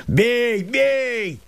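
высокий свирепый вызов; бросай «бей» как вызов; свирепо. Acted. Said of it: "свирепым голосом". (мужик свирепо подставляется под удар)

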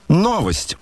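high, ringing announcement. A narrator's reading. Lady in the carriage announces news, high ringing voice. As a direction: ring it out high. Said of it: "продолжал звонкий голос".

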